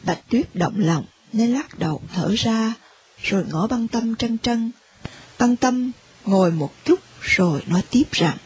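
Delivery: story narration. A narrator's reading. Narrator describes the listeners moved to tears, low and soft. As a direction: step back and narrate quietly, heavy-hearted.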